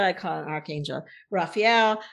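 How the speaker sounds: ordinary talk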